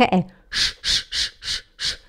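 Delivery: hissed "sch-" with no voice; sh sound demo. Shown as a demonstration. Teacher isolates the "sch" sound for students. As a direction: hush out "sch-" without voice. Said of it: "não é Schranke".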